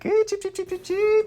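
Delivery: very high, squeaky chicken taunt; mock chicken cheeping. Friend quoting the film's chicken taunt, high and silly. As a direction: cheep rapidly in a high pitch.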